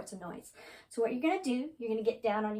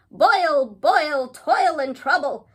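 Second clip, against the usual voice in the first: loud, higher witches' chant; witches crying out; 5 semitones higher, 13 dB louder, much slower than usual. Acted. Three witches trying to scare a ghoul, loud crying out. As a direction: cry out each word like a spell. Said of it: "Three witches were crying out".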